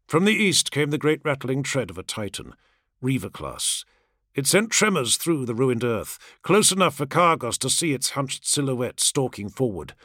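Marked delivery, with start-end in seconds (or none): none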